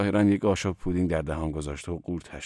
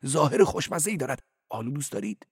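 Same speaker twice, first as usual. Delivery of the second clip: much higher, louder, fast prattling cleric; high-voiced cleric; 10 semitones higher, 4 dB louder than usual. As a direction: play the cleric high and quick. Cleric voice on phone.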